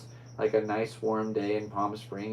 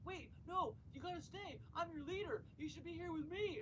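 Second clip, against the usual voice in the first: much higher put-on voice, pleading; desperate leader; 19 dB quieter than usual. Self-made king begging the crew, high voice. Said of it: "And the guy's like".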